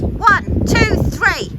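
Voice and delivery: high, loud playful count; excited countdown. Real, not acted. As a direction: count it off like a race start. Counting down before climbing steps.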